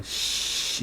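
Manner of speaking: long voiceless sh; som não vozeado. Shown as a demonstration. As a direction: hiss sh with tongue and teeth. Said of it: "faz esse som com a boca". (sh sem voz, só com a boca)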